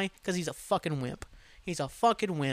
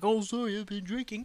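put-on higher, scornful parody of a drunk; mocking apology; 5 semitones higher, 3 dB quieter than usual. Host throwing the drunk fan's excuse back, mocking imitation. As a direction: mimic the lame excuse in a raised voice.

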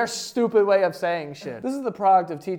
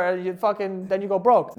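normal talking voice

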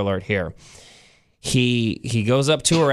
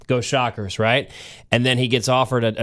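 ordinary talk, nothing marked

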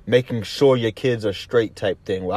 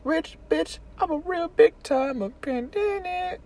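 very high squeaky put-on voice; mock high voice. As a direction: squeak it out as a parody. Imitating a song line.

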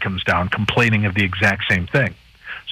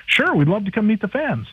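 quoting them in a much higher, chirpy voice; mock eager Ghost Hunters guys; 11 semitones higher than usual. Host quoting the Ghost Hunters agreeing to the event, in a high chirpy voice. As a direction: play the celebs as cheerfully willing, pitched high. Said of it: "And they're like".

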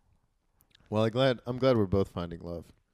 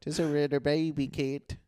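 put-on slow, high baby-talk coo; 5 semitones higher, slower than usual. Shown as a demonstration. Demonstrating talk to the cat, slow baby talk. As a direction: drag it out like cooing to a cat. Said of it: "that's how I talk to my cat".

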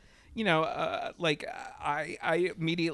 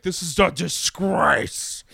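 slow, louder, sniffy celebrity huff; mock pompous outrage; 4 semitones higher, 7 dB louder, slower than usual. Mocking celebrities who call it a disgrace, in slow haughty outrage. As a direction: ham up a scandalized celebrity, slowly. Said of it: "coming out of the woodwork and being like".

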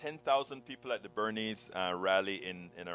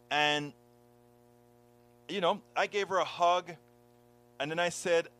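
ordinary delivery

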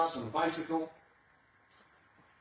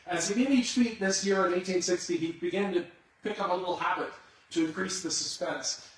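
normal talking voice

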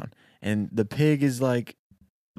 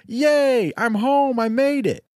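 much higher, louder triumphant yay; joyful cheer; 12 semitones higher, 5 dB louder, slower than usual. Acted. Storyteller voicing the worker celebrating his homecoming, high and loud. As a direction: celebrate like a kid who thinks he won.

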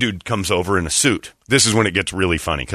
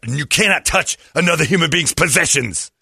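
higher, emphatic mock outrage; indignant scolding; 5 semitones higher than usual. Lady scolds the man who moved her things, indignant. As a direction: lay down the law as the offended party. Accuser scolds him in retold quarrel.

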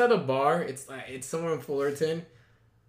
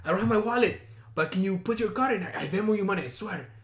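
higher put-on Armenian-Spanish accent; imitated Armenian-Spanish accent; 5 semitones higher than usual. Date asking her to put card in, imitated Armenian-Spanish accent. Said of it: "the accent goes from Armenian to fucking Spanish".

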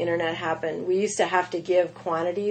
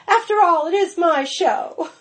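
Julia Child impression; 9 semitones higher, 3 dB louder than usual. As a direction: play Julia Child claiming her own show. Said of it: "She goes".

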